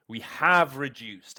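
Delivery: "have" punched, slowly and deliberately; stress on have. Shown as a demonstration. Teacher showing students the stress on "have". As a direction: lean hard on "have" in "We have reduced". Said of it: "don't underestimate the power of the emphasized word".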